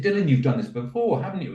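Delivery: put-on high, teasing-impressed question to a child; impressed praise. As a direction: act amazed at a child's skill.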